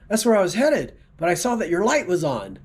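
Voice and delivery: patient delivering the punchline in a high voice. Acted.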